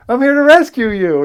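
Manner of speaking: loud, high earnest declaration; heroic rescue announcement. Retold or imitated. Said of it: "And I'm like".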